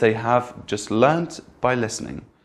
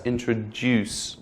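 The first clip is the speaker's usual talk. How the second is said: "Introduce" slow, final DUCE drawn out; stress at the end; 4 dB quieter, slower than usual. Shown as a demonstration. Teacher asking learners to hear it, slow end-stressed "Introduce". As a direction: stretch and stress the last syllable of "Introduce". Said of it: "Do you hear that stress?".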